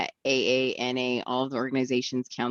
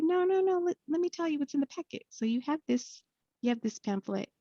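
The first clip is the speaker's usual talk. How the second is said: much higher, fast insistent helper; eager lady voice; 14 semitones higher than usual. Retold or imitated. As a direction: rattle off the packet contents eagerly.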